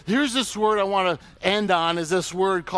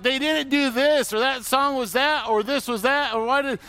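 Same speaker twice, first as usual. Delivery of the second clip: mock complainer; 6 semitones higher than usual. Mocking church complainer.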